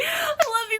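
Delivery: very high, squeaky re-enacted gush; gushing fan squeal. Fan after Scott agrees to a hug, high gushing squeal.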